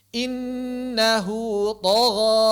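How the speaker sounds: Innahu drawn out, then tagha with a heavy tho; heavy recitation. Shown as a demonstration. Teacher recites the verse for students. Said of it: "Jangan dibalik, tagha".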